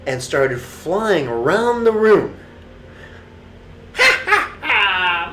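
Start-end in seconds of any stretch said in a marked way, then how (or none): 4.0-5.3 high cackling witch laugh; witch cackle. A narrator's reading. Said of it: "My name is Hilda the Witch".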